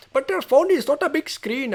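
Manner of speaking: put-on high, slow Indian-accented objection. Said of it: "I'm doing an Indian accent".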